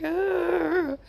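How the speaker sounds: a high whimper, then a brave little "I'm fine"; sad puppy dog. Retold or imitated. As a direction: play a wounded puppy pretending to be fine. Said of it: "he's like a little puppy dog".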